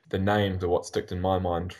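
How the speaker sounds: ordinary talk, nothing marked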